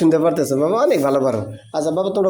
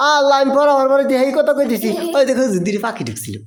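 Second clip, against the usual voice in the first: mimicking student; 7 semitones higher than usual. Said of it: "আমার জন্য দোয়া করেন".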